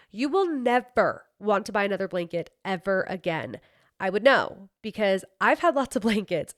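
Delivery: smooth, even sponsor read. Acted. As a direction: sell the blanket in a steady ad voice. Reads sponsor ad.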